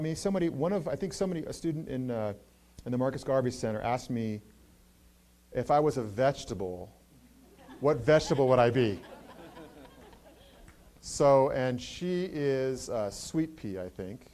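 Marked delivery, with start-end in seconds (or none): 5.5-9.0 laughing through the question; chuckling while asking. Retold or imitated. Retold student question, laughing.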